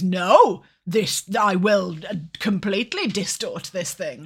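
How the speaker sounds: loud mock ADHD voice. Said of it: "the ADHD goes".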